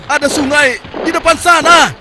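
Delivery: overjoyed soldier calling to his comrades; excited shout. Acted. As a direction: shout it to the whole troop. Soldier shouts news of river.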